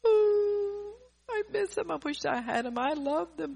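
high, loud hammed-up lament over a dead spouse; mocking the mourner who wails about missing them; mock grieving wail. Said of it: "I hear after their spouse dies".

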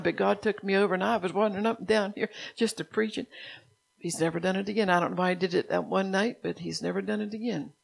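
ordinary talk, nothing marked

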